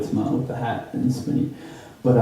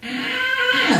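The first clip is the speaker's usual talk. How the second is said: imitated screeching noise. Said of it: "making this weird noise".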